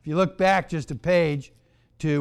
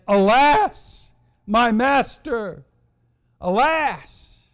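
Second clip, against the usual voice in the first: acted cry of alarm, raised pitch; 4 semitones higher, 4 dB louder, much slower than usual. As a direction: call out in mock distress, drawn out. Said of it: "Here he cries out to Elijah".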